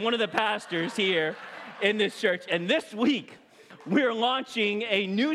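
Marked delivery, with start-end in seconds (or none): none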